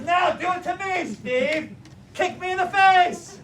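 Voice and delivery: high, loud drill-master order; acted forceful goading; shouted command. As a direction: bellow a dare at the student. Instructor demands to be kicked.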